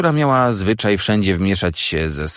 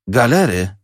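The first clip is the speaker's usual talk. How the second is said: put-on higher-pitched boy's exclamation; exclaimed; 4 semitones higher, 3 dB louder than usual. A narrator's reading. Boy turns to Joe on hearing the answer, exclaimed. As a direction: exclaim the new word, pitch up then falling. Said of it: "zawołałem, patrząc na Joe'ego".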